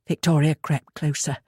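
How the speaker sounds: ordinary delivery, nothing marked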